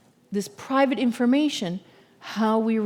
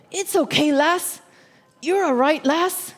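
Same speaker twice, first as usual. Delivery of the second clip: a light attempt at a Scottish accent, a little higher; a Scottish shepherd soothing his sheep, lightly imitated; gentle Scottish farmer; 5 semitones higher than usual. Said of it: "in his Scottish accent".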